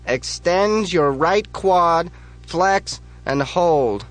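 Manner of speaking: high, loud, slow contest judge call; barked judge's command. Acted. Judge orders Bob on stage, loud slow command.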